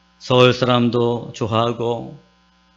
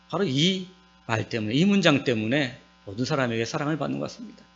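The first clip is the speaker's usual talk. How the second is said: ordinary talk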